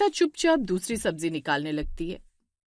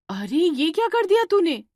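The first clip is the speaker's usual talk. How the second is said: high, louder scolding of a clumsy sister-in-law; exasperated elder sister's rebuke; angry scolding; 8 semitones higher, 4 dB louder than usual. Acted. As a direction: snap at her for ruining the dough. Divya scolds Shweta over dough.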